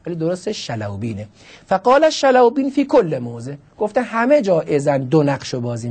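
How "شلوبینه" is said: teacherly correction of شلوبینه; correct pronunciation. Shown as a demonstration. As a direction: say شلوبینه as Shalawbin, stressing the right form.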